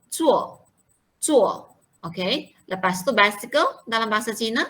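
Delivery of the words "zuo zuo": "Zuo" with a clear third-tone dip, taught slowly; correct tone. Shown as a demonstration. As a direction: model "Zuo" carefully for the class. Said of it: "perkataan zuo tu tak betul". (sebut "Zuo" dengan nada yang betul)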